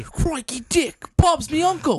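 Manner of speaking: high, hammed-up Australian; bad Australian accent. Friends trading Australian clichés, bad Australian accent. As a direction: draw it out in fake Australian. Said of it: "worst Australian accent ever".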